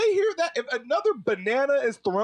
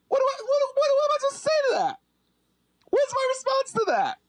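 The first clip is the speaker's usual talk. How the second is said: pleading, pitched-up protest; impassioned outcry; 8 semitones higher than usual. Real, not acted. Ranting to the podcast audience, voice climbing high in outrage.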